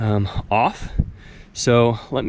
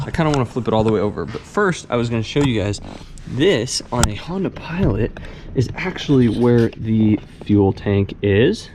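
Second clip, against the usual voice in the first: ordinary delivery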